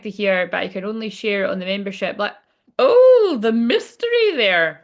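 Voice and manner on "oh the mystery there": a high, loud "oh" then a slow hushed tease; mock amazed. Reader teasing viewers about a members-only surprise, mock amazement. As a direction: tease the viewers with a gasp of wonder.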